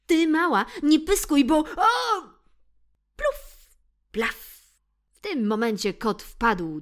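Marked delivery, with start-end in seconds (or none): 0.1-2.2 high, loud snarling cat yell; shouting cat. Acted. Bill yells at the mouse while backing off, high loud. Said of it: "kot krzyknął do niej". 3.1-4.6 splash sounds. Acted. Cat falls into puddle.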